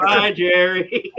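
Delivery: cheeky slow farewell to the departing cop; mock farewell. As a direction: wave him off in a teasing drawl. Mocking farewell as cop runs.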